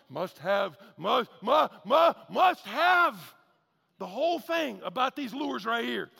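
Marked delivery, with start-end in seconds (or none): 0.1-3.3 slow, louder, stuttering craving; hypnotized fish. Retold or imitated. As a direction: drag it out like a fish in a tractor beam. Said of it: "that fish is like".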